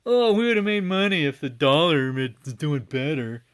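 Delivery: loud, high complaining whine; mock whining. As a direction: imitate them moaning, pitched high. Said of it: "they literally whined and complained".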